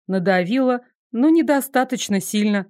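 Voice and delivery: ordinary delivery, nothing marked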